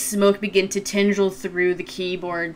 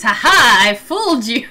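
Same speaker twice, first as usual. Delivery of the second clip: gloating taunt; 4 semitones higher, 7 dB louder than usual. Acted. GM taunts as villain.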